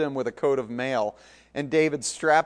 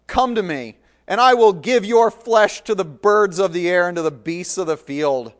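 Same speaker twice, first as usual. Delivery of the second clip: much louder menacing challenge; threatening bellow; 4 semitones higher, 9 dB louder than usual. Acted. Goliath threatens David, bellowing. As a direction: bellow the threat across the field.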